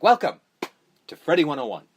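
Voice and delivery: a mock lecturer booming his greeting; imitating a creepy teacher welcoming the class; grand teacher welcome. Teacher greets them in movie.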